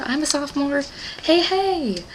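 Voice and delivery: high, sing-song delight rising to a squeal; giddy, jokey self-identification; playful fangirling. Real, not acted. Jokes about sharing Augustus's grade.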